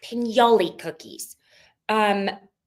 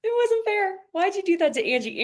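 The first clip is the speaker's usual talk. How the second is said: much higher, whiny mock complaint; 1 mock protest; 11 semitones higher than usual. Cohost defending Angie, high and mock whiny. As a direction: plead on Angie's behalf.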